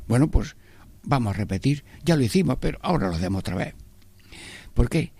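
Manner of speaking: normal talking voice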